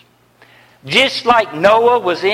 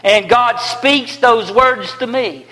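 ordinary delivery

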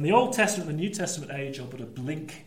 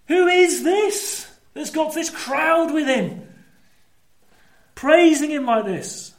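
high, slow cry of amazement; amazed; 9 semitones higher, 4 dB louder, slower than usual. Acted. Onlookers seeing Jesus ride in with a crowd, amazed. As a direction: ask it in raised-voice wonder. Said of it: "Some out of amazement".

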